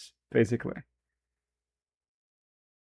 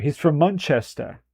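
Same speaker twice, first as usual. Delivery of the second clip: a hint of a British accent on "Manchester"; light British accent attempt; British accent try; 8 dB quieter than usual. Shown as a demonstration. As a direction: try "Manchester" again, more British, dropping letters. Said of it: "does that sound better?".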